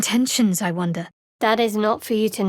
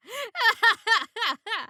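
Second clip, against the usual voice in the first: high amused laugh; a bright teasing laugh; 12 semitones higher than usual. Acted. Laughing at companion.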